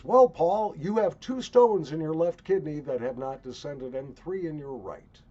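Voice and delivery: loud, high imitation of a doctor; urologist's announcement. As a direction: announce the diagnosis loudly, like a quoted specialist. Quotes urologist repeating the diagnosis.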